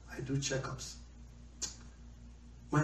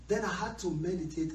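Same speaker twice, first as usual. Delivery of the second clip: ordinary delivery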